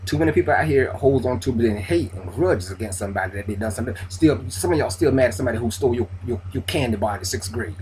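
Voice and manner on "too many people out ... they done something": normal talking voice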